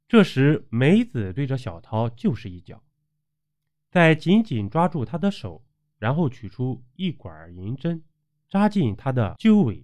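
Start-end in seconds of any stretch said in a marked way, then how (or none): none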